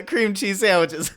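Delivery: usual speaking voice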